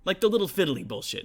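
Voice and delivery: loud, high calling blacksmith; loud orc brother. Acted. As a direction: shout across the forge. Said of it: "He goes".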